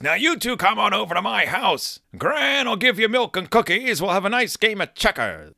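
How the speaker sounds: lightly voiced gentle grandfather; kindly Gramps. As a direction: make up with the kids, inviting. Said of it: "'Cause he says".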